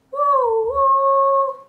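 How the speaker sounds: high loud whistle woo woo; train whistle woo. Shown as a demonstration. Conductor to kids, a loud high train whistle. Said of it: "I want you to go".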